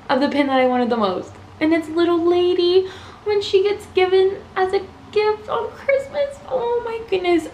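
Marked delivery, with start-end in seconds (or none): 1.6-7.5 almost crying over the gift scene; teary joy. Real, not acted. Collector choking up at her chaser pin, teary and high. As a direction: voice breaking high with happy tears. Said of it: "my roller coaster of emotions".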